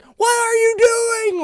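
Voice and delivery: high shouted alarm; a cousin's mock yell of alarm. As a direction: yell it at the oncoming driver. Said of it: "my cousin's like".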